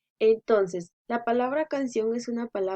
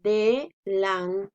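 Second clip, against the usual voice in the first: Delantal split slowly as de, lan, tal, with pauses; syllable by syllable; 2 semitones lower, much slower than usual. Shown as a demonstration. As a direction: break Delantal into three slow syllables, firm on tal. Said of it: "la separamos así". (Delantal separado en sílabas, de-lan-tal, lento)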